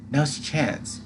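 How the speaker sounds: ordinary delivery, nothing marked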